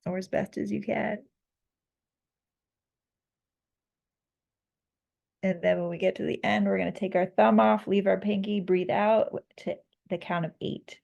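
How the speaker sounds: calm, measured counting voice; guided breathwork. Acted. Leads a breathing exercise.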